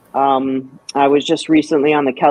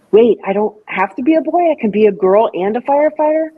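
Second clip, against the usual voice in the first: put-on amazed little girl; 8 semitones higher than usual. Little girl's amazed thought.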